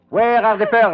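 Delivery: loud, sharp demand at gunpoint; menacing shout. Acted. Villain shouting at the cornered woman for the pearls. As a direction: bark the question as a final threat.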